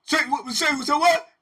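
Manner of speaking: loud, high angry challenge; warden challenging. Retold or imitated. Warden challenging the hidden heckler, loud and high. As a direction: demand who said it. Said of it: "he said".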